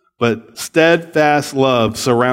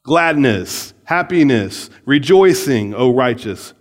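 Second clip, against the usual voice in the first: usual speaking voice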